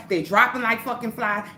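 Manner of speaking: normal talking voice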